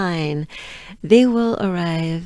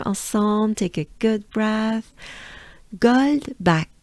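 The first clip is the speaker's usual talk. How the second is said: ordinary talk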